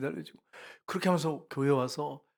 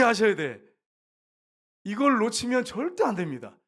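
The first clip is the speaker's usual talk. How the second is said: louder, higher emphatic pulpit voice; forceful preaching; 6 semitones higher, 6 dB louder than usual. Real, not acted. Preacher presses congregation on who is great, forceful preaching. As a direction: drive the point home to the congregation. Preacher drives home his point.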